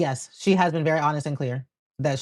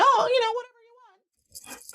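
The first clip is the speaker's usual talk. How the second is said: very high, airy imitation; put-on breezy whatever; mock nonchalance. Mocking a falsely easygoing denial.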